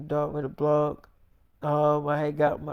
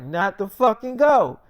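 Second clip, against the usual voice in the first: angry retort; 4 semitones higher, 7 dB louder than usual. Retold or imitated. Heated argument with girlfriend.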